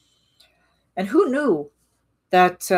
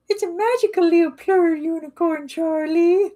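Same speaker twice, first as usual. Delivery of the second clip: much higher, sparkly squeak; squeaky unicorn voice; 12 semitones higher than usual. Pretend. Streamer greets chat in squeaky unicorn voice.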